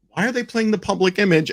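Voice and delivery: high-pitched baffled question; puzzled listener. Retold or imitated. Host voicing a listener caught off guard, puzzled and high. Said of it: "In the beginning, you're like".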